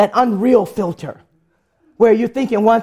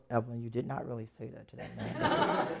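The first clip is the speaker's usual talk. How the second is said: low, very soft mock reproach; hushed scolding; 10 semitones lower, 16 dB quieter than usual. Friend behind her after the blunder, hushed low scolding. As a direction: imitate an appalled friend muttering behind her.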